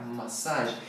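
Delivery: Massage said ma-SAHZH, no final j; English massage. Shown as a demonstration. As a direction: end Massage softly with zh. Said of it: "Não tem nem o j no final". (Massage sem o j no final, massáj)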